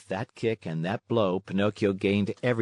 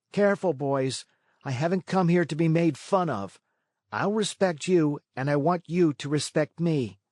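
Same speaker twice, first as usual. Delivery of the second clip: threatening; 6 semitones higher than usual. A narrator's reading. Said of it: "he said to them threateningly".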